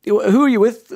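high, loud impersonated questioning; Joe Brolly. Acted. Pundit quizzing a young impressionist on the phone, high impersonation. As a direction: put on the pundit's voice, pitched well up. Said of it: "rang up Joe Brolly / he goes".

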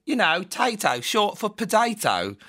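put-on high, loud English girl accent; imitated English accent. English girl explains her nickname to him, imitated English accent. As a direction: put on an English girl's voice, drawn out. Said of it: "And she was like".

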